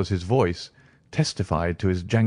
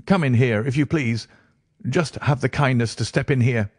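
higher reedy voice, urging; nervous patient, insistent; 5 semitones higher than usual. Acted. Patient showing Holmes into his bedroom, nervously insistent. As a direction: usher them along in a raised, edgy voice.

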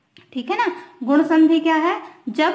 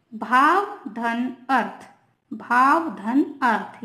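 slow, each word of भाव धन अर्थ set apart; slow dictation; slower than usual. Shown as a demonstration. Teacher slowly dictating भाव धन अर्थ to students. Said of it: "देखिए example के through".

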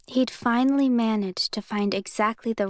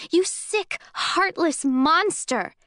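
much higher, slow screamed insult; shrieked insult while throwing a pillow; furious shout; 8 semitones higher, 4 dB louder, slower than usual. Acted. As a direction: hurl the words at him. Hurling the pillow at him.

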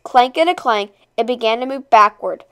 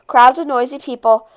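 ordinary delivery, nothing marked

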